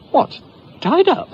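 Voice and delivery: high, loud alarmed question; mother aghast at a loose tiger. Acted. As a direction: shriek it in disbelief.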